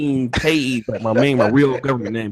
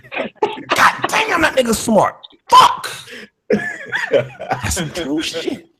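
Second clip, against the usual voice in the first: mock amazement; 12 semitones higher, much slower than usual. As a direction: marvel as if at pure genius. Acted awe at taxes remark.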